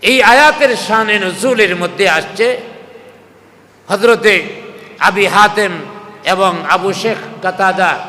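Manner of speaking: usual speaking voice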